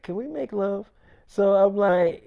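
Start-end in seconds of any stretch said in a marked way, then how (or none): 0.0-0.9 very soft retold pillow talk; intimate request. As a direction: ask it softly, as a lover would. Girlfriend's intimate request in retold scene.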